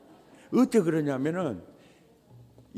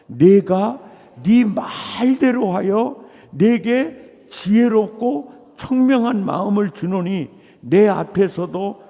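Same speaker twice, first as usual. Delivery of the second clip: loud, high proclaiming of scripture; loud, soaring preaching; preaching at full force; 4 semitones higher, 8 dB louder than usual. Acted. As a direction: thunder the verse at the congregation.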